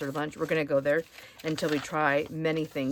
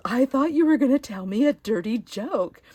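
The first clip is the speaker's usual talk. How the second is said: excited confession; 7 semitones higher, 7 dB louder than usual. Retold or imitated. Waitress admits what she expected from the joke, excited. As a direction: imitate the waitress, louder and higher. Waitress reveals her misunderstanding.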